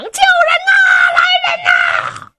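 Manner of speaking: very high-pitched cry for help; 高声喊嚷. Acted. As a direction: yell for rescue, stretching out the last words. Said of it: "徐世芳高声喊嚷". (扯着嗓子高声呼救)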